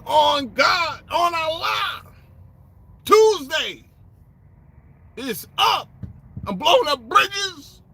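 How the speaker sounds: mock boastful quote. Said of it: "He's like".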